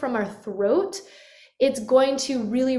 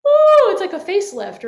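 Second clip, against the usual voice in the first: much higher, louder lifted ooh; open yawny voice; 9 semitones higher, 10 dB louder than usual. Shown as a demonstration. Teacher demos a lifted yawning ooh. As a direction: speak through a yawn-opened mouth. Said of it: "Notice the roof of the mouth lifting".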